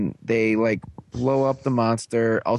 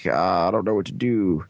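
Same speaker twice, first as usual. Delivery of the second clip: lightly mopey, lower pitch; lower mopey whine; 5 semitones lower than usual. Retold or imitated. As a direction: moan like a defeated hero. Hero lying defeated.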